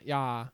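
"Yeah" said as "yah", with open ah; [name]. Retold or imitated. Co-host showing off the accent, "Yeah" as "yah". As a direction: make "Yeah" rhyme with spa. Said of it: "there's your accent".

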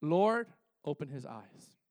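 low, slow and hushed; hushed prayer. Shown as a demonstration. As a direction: pray it low and slow, almost to yourself. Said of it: "watch this".

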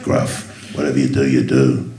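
low, gruff whispered growl; breathy, deep movie tough-guy mumble; Jason Statham voice. Retold or imitated. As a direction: rasp it low like a hard-man action star. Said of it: "we struggled with the voice and then just came up with Jason Statham".

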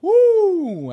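high yelp from a burnt tongue; sudden loud woo of pain. Real, not acted. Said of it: "I burned my tongue".

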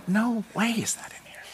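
hushed disbelief, barely breathed; a stunned whisper over a hidden note; awed whisper. Retold or imitated. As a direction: whisper it like you can't believe your eyes.

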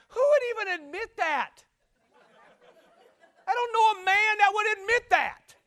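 high, loud preacher's yell; incredulous outburst. Real, not acted. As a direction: holler in comic disbelief. Preacher mock-incredulous at the confession.

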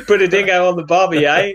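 quick, loud, high Aussie caricature; hammed-up Australian, half laughing; mock Australian accent. Friend teased about his American accent, answers in cartoon Australian. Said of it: "That's not American".

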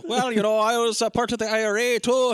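high, loose mock Irish accent; Richard Gere's Irish accent. Irishman answers the Russian about his past, mock Irish accent. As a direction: parody a bad film Irish brogue. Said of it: "his Irish accent is".

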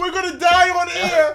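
high, loud cry through laughs; helpless laughter. Real, not acted. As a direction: wail it out while cracking up.

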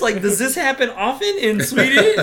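high-pitched, comic yelling disbelief; incredulous outrage. Retold or imitated. Viewer questioning this Swedish movie, incredulous outrage. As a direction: cry it out, scandalized and half laughing.

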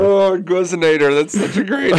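high giggly delight; laughing. Real, not acted. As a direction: squeal it through laughter. Delighted by the word.

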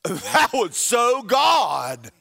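high, loud, stretched-out holler; thrilled exclamation. Pretend. Imagined believer amazed by God in the shower, high thrilled shout. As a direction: play an overjoyed believer, drawing the words out.

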